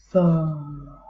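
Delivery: ordinary delivery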